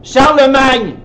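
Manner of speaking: loud, high blurted answer; radio caller shout. Retold or imitated. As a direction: yell it like a quiz caller. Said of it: "il y avait comme un vide". (cri d'auditeur à la radio)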